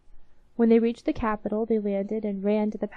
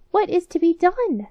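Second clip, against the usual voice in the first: worried queen; 8 semitones higher, 7 dB louder than usual. Acted. Queen of mice in dismay.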